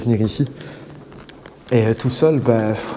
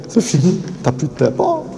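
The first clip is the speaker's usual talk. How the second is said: a light Belgian accent, pitched higher; lightly put-on Belgian accent, higher; 7 semitones higher than usual. Shown as a demonstration. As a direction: lean into a thick Belgian accent. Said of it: "mon petit accent belge".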